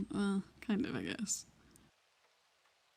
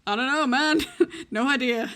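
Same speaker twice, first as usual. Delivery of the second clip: loud, higher, hammed-up cluelessness; feigned ignorance; 4 semitones higher, 7 dB louder than usual. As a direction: loudly play dumb, laughing through it. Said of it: "I just had to go".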